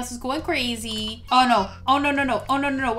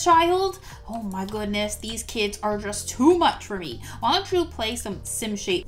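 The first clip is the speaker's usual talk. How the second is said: ordinary delivery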